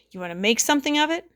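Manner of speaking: put-on tough little kid voice. A narrator's reading. Little boy squaring up to the girls, tough guy voice. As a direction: challenge them like a tiny tough guy.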